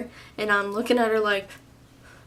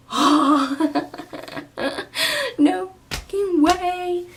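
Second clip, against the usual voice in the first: gasp, then high, slow disbelief; acted shock; 9 semitones higher, much slower than usual. Young woman just finding out, mock-shocked. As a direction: play up the shock with a high-pitched laugh. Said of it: "you just found out, like, you'd be like".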